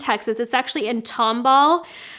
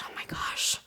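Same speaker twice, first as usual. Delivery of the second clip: very soft, lower, almost voiceless aside; hushed disbelief; 12 dB quieter than usual. Real, not acted. Vlogger realizing she turns forty in December, hushed disbelief. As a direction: drop to a near-whisper. Dismay at turning forty soon.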